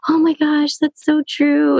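imitating a mom's high, drawn-out agreement; awed mom. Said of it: "she was like".

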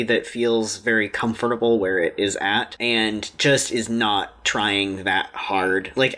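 low, slowed, weighty delivery. Real, not acted. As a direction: drop down low to drive it home. Slow deep serious point.

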